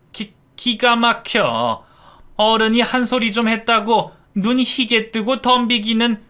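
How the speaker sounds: put-on a shocked old woman reeling; indignant mother-in-law. A narrator's reading. Mother-in-law scolding the daughter-in-law, high and scandalized. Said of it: "하면서 휘청거렸어요".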